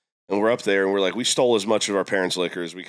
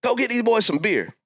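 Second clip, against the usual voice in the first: much higher, hammed-up bossy order; neighbor's girlfriend; 12 semitones higher than usual. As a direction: send him off like the woman in charge. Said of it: "She goes".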